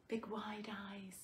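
hushed whisper of delight. Real, not acted. Cooing over the sleeping baby.

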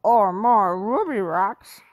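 slow loud dog speech; hopeful dog; Scooby-Doo voice. A narrator's reading. Scooby hoping for snacks in dog speech. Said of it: "said Scooby".

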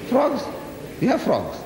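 high, mock-surprised question; teasing disbelief at no frogs. Real, not acted. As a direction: raise it in playful disbelief.

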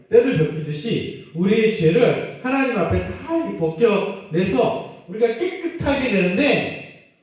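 usual speaking voice

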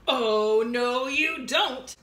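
loud, very slow booming goat; big goat defiant. Acted. Biggest goat defying the troll, loud and slow. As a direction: boom it out slowly.

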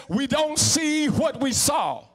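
each word drawn out slowly and called out; slow, ringing preacher's quote; slow, preached quote. Retold or imitated. Preacher repeating the doctor's good news to the church, slow and ringing. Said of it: "he says".

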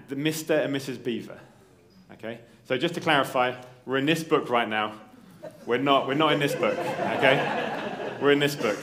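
ordinary talk, nothing marked

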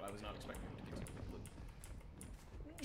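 normal talking voice

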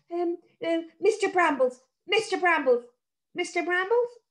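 put-on a scared girl summoning a sprite; calling out. A narrator's reading.

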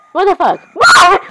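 high, jolted disbelief, second what shouted; shocked. Acted. Hearing their insides are dead, shocked yelp. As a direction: caught off guard, blurt it out.

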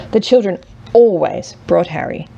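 plain reading voice

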